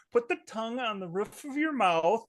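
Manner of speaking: improv teacher. Retold or imitated. Mimicking the improv teacher's tongue notes, high mock-teacher voice. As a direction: imitate a fussy accent coach. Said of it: "She, like".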